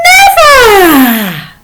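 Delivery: high, loud drawn-out never; playful stretched defiant never; emphatic never. Shown as a demonstration. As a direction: refuse with a laugh. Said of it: "Just repeat them".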